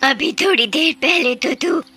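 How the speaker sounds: high witch voice, stretching the final threat; taunt, then threaten like a witch. Acted. Witch threatening to eat horse and subjects, menacing.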